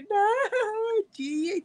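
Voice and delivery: high, breaking sob on the no; a mock whimper of tears; imitating her own crying. Vlogger showing how she bursts into tears. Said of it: "cuando ya rompo en llanto, así de que".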